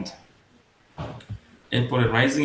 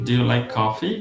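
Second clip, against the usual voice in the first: "coffee" swings up at the end; yes-no question climbing on "coffee"; rising intonation; slower than usual. Shown as a demonstration. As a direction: lift "coffee" to ask. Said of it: "উপরে যাবে".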